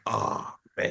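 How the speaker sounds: soft breathy letdown; whispered dismay. Retold or imitated. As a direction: sigh it out, crushed. Disappointed reaction retold.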